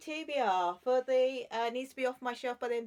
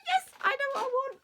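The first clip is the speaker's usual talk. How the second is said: excited; 7 dB louder, faster than usual. Real, not acted. Excited book pick, sing-song.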